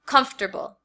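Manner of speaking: comfortable squeezed to three syllables, KUMF-ter-bul. Shown as a demonstration. Teacher showing students her everyday comfortable. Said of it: "this is how I normally say the word".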